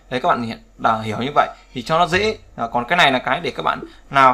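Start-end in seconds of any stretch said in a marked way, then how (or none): none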